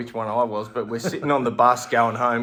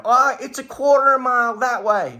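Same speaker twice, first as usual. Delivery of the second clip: much higher, louder, singsong helpful stranger; mock cheery local; 12 semitones higher, 8 dB louder than usual. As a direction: squeak out the same old directions. Same answer, pitched much higher.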